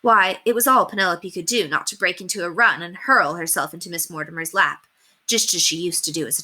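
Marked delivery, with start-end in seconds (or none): none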